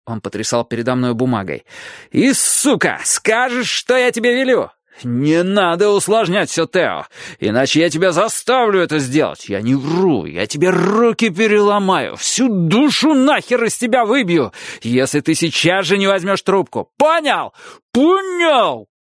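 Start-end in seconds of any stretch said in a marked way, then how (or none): 2.1-4.7 angry shout. A narrator's reading. Father threatens son. 5.0-16.9 threat building to screaming; rising furious yell. A narrator's reading. 17.0-17.5 yelled high and loud; screamed question. A narrator's reading. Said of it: "повторил он". 17.9-18.9 even higher screamed repeat. A narrator's reading. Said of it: "повторил он".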